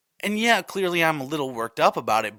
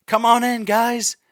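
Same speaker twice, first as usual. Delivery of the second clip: loud, higher hosting call; host's call; 6 semitones higher, 6 dB louder than usual. Retold or imitated. Host calling contestants in, loud and higher. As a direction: shout them in like a show host. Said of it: "Jeff says".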